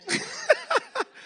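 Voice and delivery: sudden high burst of laughter. Real, not acted. Host laughing as he greets his friend, high laugh.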